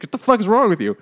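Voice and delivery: a touch of hammed-up rage at the hooker; mock angry yell. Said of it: "He's like".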